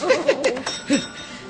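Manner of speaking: a full delighted laugh; hearty laugh. Acted. Hearty laugh after ordering. (luide, vrolijke lach)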